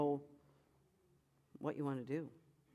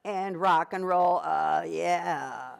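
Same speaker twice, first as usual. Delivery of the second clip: slack folds; 7 dB louder, slower than usual. Shown as a demonstration. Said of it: "the first one is slack".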